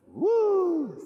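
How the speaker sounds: high held cheer; excited woo. Shown as a demonstration.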